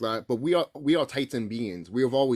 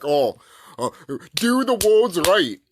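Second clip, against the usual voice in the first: slow, high, louder whiny mock of a nitpicker; sarcastic imitation of an annoying critic; 5 semitones higher, 6 dB louder, slower than usual. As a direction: parody the pedants who correct him, drawn out. Mocking critics of his pronunciation.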